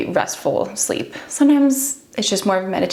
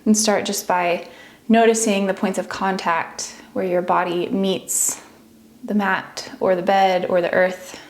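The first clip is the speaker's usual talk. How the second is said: unhurried meditation voice with long pauses; guided meditation. Acted. Teacher talks listeners into relaxing each body part, guided meditation. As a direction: ease them gently through a body scan, leaving space between phrases.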